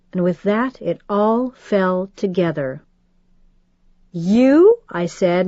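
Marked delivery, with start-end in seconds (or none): 4.1-4.8 squeaked high and loud; a startled high-pitched squeak; shocked squeak of disbelief. A narrator's reading. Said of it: "my voice a high squeak".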